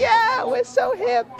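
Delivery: very high, drawn-out silly voice; exaggerated high mock-cool reply; self-mocking hipness. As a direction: squeak it slowly, mocking your own coolness. Mocking own reply to spelling.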